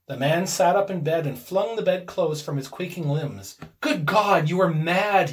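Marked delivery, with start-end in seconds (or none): 3.8-5.3 louder, higher, horrified cry; aghast. A narrator's reading. Husband horrified at his wife's wish, aghast. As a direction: exclaim in shocked disbelief. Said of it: "he cried aghast".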